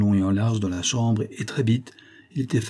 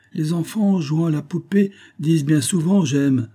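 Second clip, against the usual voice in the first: higher-pitched paternal reproof; scolding father; 7 semitones higher, 3 dB louder than usual. A narrator's reading. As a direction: lecture a daughter as a silly child. Father scolds his young daughter.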